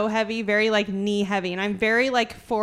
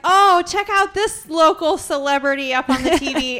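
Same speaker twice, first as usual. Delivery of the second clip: mock excited; 6 semitones higher, 7 dB louder than usual. Retelling a mocking remark about her.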